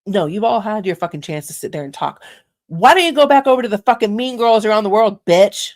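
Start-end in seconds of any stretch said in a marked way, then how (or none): 2.7-5.7 loud angry yell at the chat. Real, not acted. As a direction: shout it out, furious. Yelling insults at chat.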